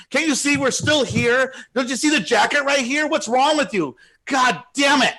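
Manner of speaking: high, loud furious rant; imitating an irate diner yelling at a stranger; angry yelling lady. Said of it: "starts yelling at me really bad".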